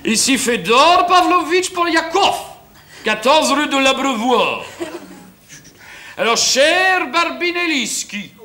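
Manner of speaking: slow, high, hammed-up Russian; Poliakov's fake Russian accent. Broke husband orders food as Poliakov, hammed-up Russian accent. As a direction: roll grandly through a badly faked Russian accent. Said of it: "Il prend l'accent russe".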